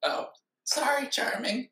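small, high, soft cartoon voice; apologetic cartoon sponge. Sponge apologizing to co-host, soft cartoon voice.

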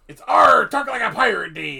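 loud, high pirate growl; pirate arr. Pretend. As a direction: bellow it like a pirate.

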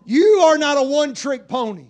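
loud rising preacher cadence; full-force preaching. Acted. Pastor rousing the church, loud preaching. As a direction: build the sermon to a roar.

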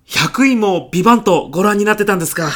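eager fan finding a fellow fan; excited. Acted. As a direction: boss asks the client, thrilled. Excited discovery executive watched show.

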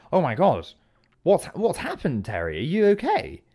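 high, swooping shocked questions; hammed-up horrified worry; alarmed concern. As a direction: fuss over an injured friend in dismay. Pooh alarmed for injured Terry.

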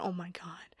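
hushed, breathless mock panic; whispered oh my god. Staff whisper in alarm over a coworker's cough. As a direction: gasp it out under your breath.

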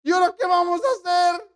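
servant wailing in despair at the surrounding army; desperate servant. Acted. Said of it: "y desesper".

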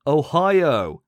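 thrilled game-show reveal of the result; excited reveal. Real, not acted. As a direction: announce it like a shock winner. Announcing surprise competition result.

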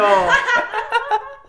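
high squealing laugh; a sudden high laughing fit; burst of laughter. Real, not acted. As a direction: crack up laughing hard. Joking about noodle brand.

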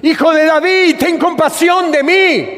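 high-pitched pleading shout. Acted. Blind man crying after Jesus to be heard, acted loud cry. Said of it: "grita más fuerte vos, que no te escucha".

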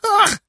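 very high, loud bird screech; short harsh squawk of a jay; jay's scream. A narrator's reading. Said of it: "screamed the jay".

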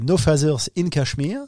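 English title in a French accent; mauvais accent français. Real, not acted. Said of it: "avec mon accent pourri". (accent français pourri en anglais)

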